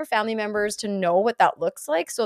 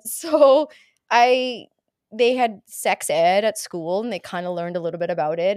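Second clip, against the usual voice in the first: ordinary delivery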